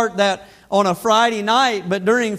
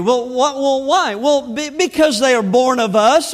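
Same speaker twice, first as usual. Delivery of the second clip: usual speaking voice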